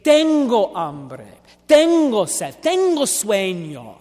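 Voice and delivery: "Tengo hambre, tengo sed, tengo sueño" said slowly with Spanish vowels, tengo as TEN-go; slow, careful Spanish phrases; in Spanish, dicho despacio; spoken Spanish. Shown as a demonstration. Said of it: "in Spanish they say".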